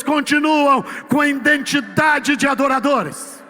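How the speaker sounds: loud, driving sermon voice building to a shout; fervent preaching. Real, not acted. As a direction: preach it at full force, hammering each "todo". Preaching at full force. (pregação inflamada e em voz alta)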